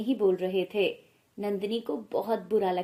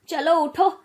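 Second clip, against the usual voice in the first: higher, louder barked order; impatient shout; 5 semitones higher, 7 dB louder than usual. Acted. Mother-in-law shouting at the fallen bride to get up, impatient. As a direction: yell at her to get up now.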